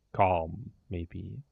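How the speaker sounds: ordinary talk, nothing marked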